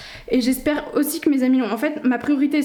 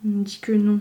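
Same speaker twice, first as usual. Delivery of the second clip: usual speaking voice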